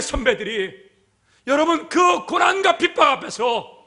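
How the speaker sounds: loud, high-pitched impassioned preaching; full-force preaching. Real, not acted. Preacher recalling the faith forebears' tears, full-force preaching. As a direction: cry it out forcefully to the congregation.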